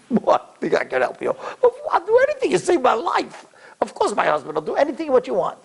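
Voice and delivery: high-pitched, incredulous gushing; effusive agreement. Retold or imitated. Kidney recipient promising her donor anything, effusive. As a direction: gush as if the favour needn't even be asked. Grateful patient answers donor's request.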